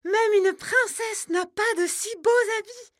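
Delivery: put-on high, loud, delighted girl's voice; girl's exclamation. Margot thanks the dove, high and delighted. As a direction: cry out your thanks like an enchanted young girl. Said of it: "s'exclame la jeune fille".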